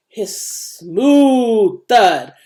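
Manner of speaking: whispered hiss, loud lowing moo, heavy thud; voiced hiss moo thud. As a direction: act out the nearby machine noise. Nearby slaughterhouse noise voiced.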